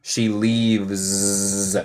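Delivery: "She leaves." ending in a buzzing "z"; teacher's model "She leaves."; bee-like z. Shown as a demonstration. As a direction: buzz the end of "leaves". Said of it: "Suena como abeja".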